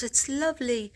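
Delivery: light Irish lilt on lovely; Irish accent. Retold or imitated. Retelling the Irish psychic's praise, a mild Irish lilt. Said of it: "I love the way the Irish talk".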